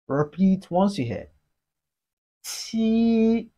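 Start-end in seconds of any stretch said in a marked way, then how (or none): 2.4-3.5 "qi" held high and level; flat tone. Shown as a demonstration. Teacher models Chinese seven for learners, flat-tone "qi". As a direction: sustain "qi" on one unbending pitch. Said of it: "It has a flat tone".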